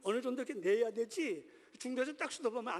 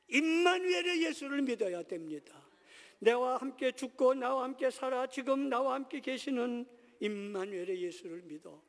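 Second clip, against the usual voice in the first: raised, loud pulpit proclamation; emphatic preaching; 5 semitones higher, 7 dB louder than usual. Real, not acted. Preacher tells congregation to believe in Immanuel, emphatic preaching. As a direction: proclaim it forcefully, pitch lifted.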